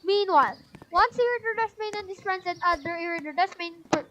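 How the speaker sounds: ordinary delivery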